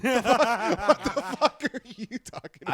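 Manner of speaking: loud, slow, high disbelief; incredulous. Real, not acted. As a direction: stretch it out in disbelief. Incredulous outburst at friend.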